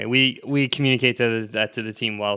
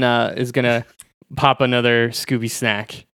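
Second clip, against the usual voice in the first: usual speaking voice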